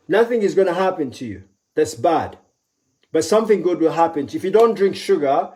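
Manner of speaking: normal talking voice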